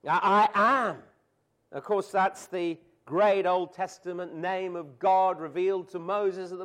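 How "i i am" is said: I AM hit loud and firm; emphatic I AM. Shown as a demonstration. Preacher showing the Greek emphasis, emphatic. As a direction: land I AM like a proclamation. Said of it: "in the Greek it's emphatic".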